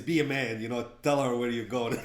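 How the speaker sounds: loud, emphatic quote of a friend; imitated pushy advice. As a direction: say it like a forceful order. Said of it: "So Joe always says".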